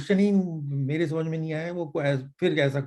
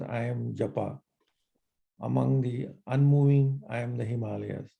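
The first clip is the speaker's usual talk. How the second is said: scripture recitation; 5 semitones lower, 4 dB quieter than usual. Acted. A reader chanting Gita verses to the study group. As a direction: recite each verse, then translate it line by line.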